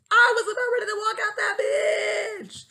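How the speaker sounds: high, loud dramatic shout; yelled outburst. Real, not acted. New teacher venting about nearly quitting, yelled outburst. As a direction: yell it out, stretching the last word.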